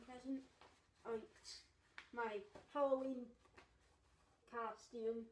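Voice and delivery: speaking inside rubber mask; muffled through full head mask. Real, not acted. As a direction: voice covered by latex mask. Seen 4: Latex horse mask covers his whole head while speaking; taken off near 5.1.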